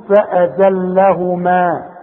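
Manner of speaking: فأزلهما very slowly, final maa held long; alif kept at pause. Shown as a demonstration. Quran teacher shows stopping on فأزلهما, long final alif. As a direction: stretch the end of فأزلهما into a full maa. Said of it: "تثبت الألف عند الوقف".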